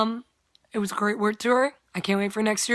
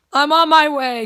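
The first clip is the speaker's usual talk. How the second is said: quick, louder, higher excited answer; eager reply; 5 semitones higher, 7 dB louder than usual. Retold or imitated. Friend rushing to meet her crush, excited. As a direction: gush it like she's already running out.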